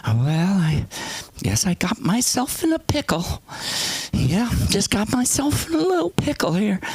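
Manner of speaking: high, put-on old-lady voice; high, mock-casual self-pity; passive aggressive mother. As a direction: imitate mom hinting that she is stuck. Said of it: "she starts going".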